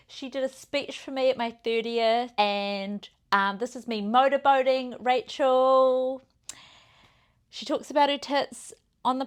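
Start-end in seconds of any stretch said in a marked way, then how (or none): none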